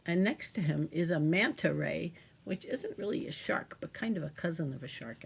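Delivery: ordinary talk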